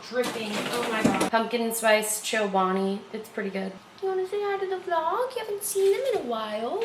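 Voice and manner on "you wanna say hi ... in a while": high, sing-song pet-talk voice. Real, not acted. Talking to her dog.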